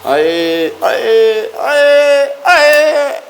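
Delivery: rising bird-like taunt; building teasing call. Real, not acted. Teasing the hen again.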